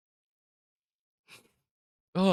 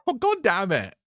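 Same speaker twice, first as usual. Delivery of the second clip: loud, high "Oh, God damn it" yelled at the screen; frustrated shout; 7 semitones higher, 7 dB louder than usual. Real, not acted. Viewer yelling at the anime as a character dies, loud and frustrated. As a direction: blurt it out in real frustration.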